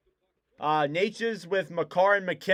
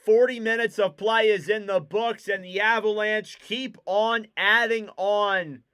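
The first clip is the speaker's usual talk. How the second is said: period wrap-up; 4 semitones higher, 4 dB louder than usual. Acted. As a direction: land the end of the period.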